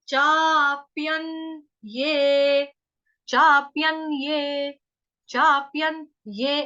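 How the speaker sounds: चाप्यन्ये with long चा, slow; slow chaapyanye. Shown as a demonstration. Teacher drills long vowel in चाप्यन्ये slowly. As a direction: stretch the चा in चाप्यन्ये. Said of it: "दीर्घ मात्रा है".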